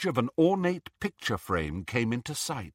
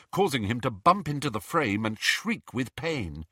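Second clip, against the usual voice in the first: usual speaking voice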